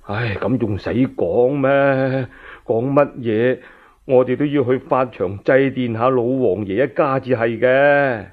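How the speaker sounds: an aged beggar sighing, unhurried; old man. Acted. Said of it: "有個白頭老翁話啦".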